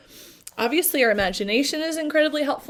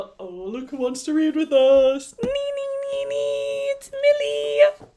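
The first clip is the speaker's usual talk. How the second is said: pet talk; 4 dB louder than usual. Real, not acted. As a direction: coo at the dog. Greeting the dog Millie.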